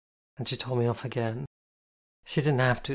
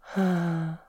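imitated happy sigh, pitched up; mock dreamy sigh; 5 semitones higher than usual. Jane mocks Kitty's happy sigh with a dreamy sigh. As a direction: tease with an exaggerated lovesick sigh. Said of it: "Jane imitated my sighing".

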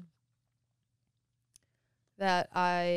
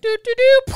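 high, loud "doo-doo-doo"; carefree sung-out flying sound effect; imitated flying noise; 18 dB louder than usual. Imitating co-host flying along, playful high noise.

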